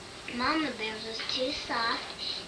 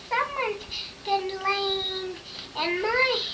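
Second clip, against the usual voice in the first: put-on Baby Bear; 6 semitones higher, slower than usual. Baby Bear voiced in story.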